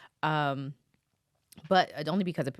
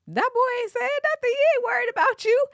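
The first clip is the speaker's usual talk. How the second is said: much higher, louder put-on voice; guy on the phone; 7 dB louder, faster than usual. Host imitating a caller on a jail phone. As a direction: mimic the dismissive caller. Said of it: "the guy on the phone is like".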